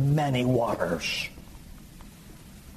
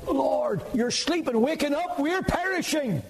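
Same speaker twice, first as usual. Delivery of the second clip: high-pitched cry of desperation; dramatized alarm, pitch soaring; acted panicked plea. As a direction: cry out like a disciple begging to be saved. Acts out disciples' panicked cry.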